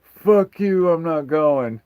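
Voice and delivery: put-on a teenage son yelling back at his mom, loud and defiant; defiant teen shout. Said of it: "and he goes".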